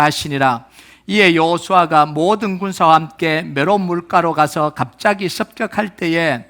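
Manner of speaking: firm, measured read-aloud for a congregation; formal, evenly projected recitation; scripture reading. Real, not acted. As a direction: lead the verse in steady unison pace.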